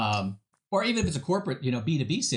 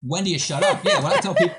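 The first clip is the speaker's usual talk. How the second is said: laughing through the words, pitch jumping high; cracking up at shutting up; 5 semitones higher, 6 dB louder than usual. Real, not acted. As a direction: giggle your way into it. Laughs at "when to shut up.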